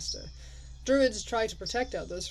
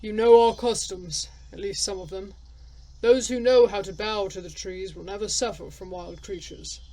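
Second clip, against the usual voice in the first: stern elder; 8 dB louder than usual. Acted. Voices the elder.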